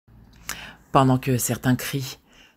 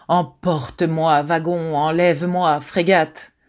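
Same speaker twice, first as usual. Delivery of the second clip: loud, raised declamation; impassioned plea; 4 semitones higher, 5 dB louder than usual. Acted. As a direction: cry it out like a verse of escape. Impassioned plea quoted inside poem recital.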